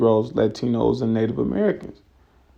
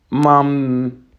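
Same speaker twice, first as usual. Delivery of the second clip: ordinary talk, nothing marked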